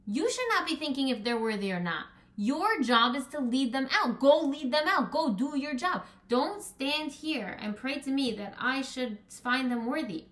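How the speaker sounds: God's forceful rebuke; stern rebuke. Acted. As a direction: scold him to get up and lead. Hashem rebuking Moshe.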